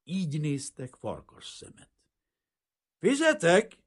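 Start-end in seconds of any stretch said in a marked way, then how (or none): 3.0-3.8 high, loud call for the waiter; raised, carrying shout. A narrator's reading. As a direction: call out across the room. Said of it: "kiáltott Aronzon".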